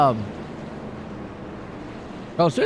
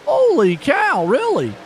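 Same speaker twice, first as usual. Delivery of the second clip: a drawn-out, higher Holy cow in real shock; can't believe what he just saw; stunned disbelief; 7 semitones higher, 3 dB louder, slower than usual. Real, not acted. Commentator stunned the wreck got no caution, real surprise.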